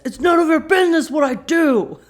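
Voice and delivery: loud, high furious yell; angry shout. Retold or imitated. Sam lashing out at Bobby, furious shout. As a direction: snap it back at him at full volume. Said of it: "Sam gets his angry voice back".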